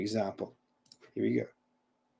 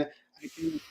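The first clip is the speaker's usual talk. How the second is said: audio fading out; 14 dB quieter than usual. Real, not acted. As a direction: voice dropping away on a bad connection. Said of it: "your audio kinda faded away".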